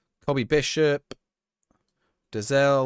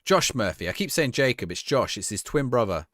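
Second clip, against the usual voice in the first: ordinary talk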